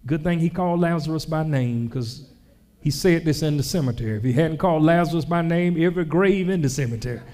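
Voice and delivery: ordinary talk, nothing marked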